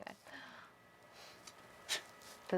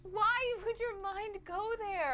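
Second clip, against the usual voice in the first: high, slow disbelief; baffled and amused; incredulous; 10 semitones higher, slower than usual. Real, not acted. Friend reacts to weird joke.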